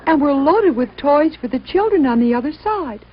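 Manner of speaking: high, calling little train voice; little train begging a small engine for help, high and pleading. Acted. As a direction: call out and beg the passing engine. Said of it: "the little train called out to him".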